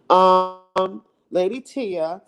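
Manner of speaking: ordinary talk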